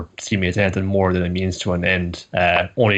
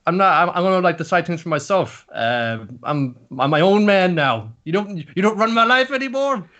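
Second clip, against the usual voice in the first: firm, not angry; 9 semitones higher, 3 dB louder than usual. Retold or imitated. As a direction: state it firmly, much higher than usual. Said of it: "less angry with her, more so stating it".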